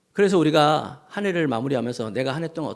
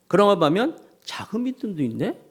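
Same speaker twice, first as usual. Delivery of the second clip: usual speaking voice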